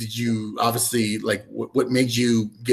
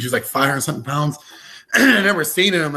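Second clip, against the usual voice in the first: ordinary talk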